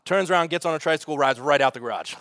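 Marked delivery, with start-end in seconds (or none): none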